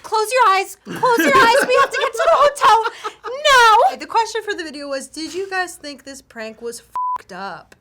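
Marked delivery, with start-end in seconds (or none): 0.0-3.9 high, loud laughing panic; panicked mom. Retold or imitated. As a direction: shriek it while laughing, fast. Mom shielding kids, laughing.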